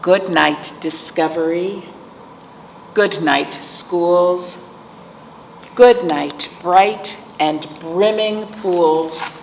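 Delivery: ordinary delivery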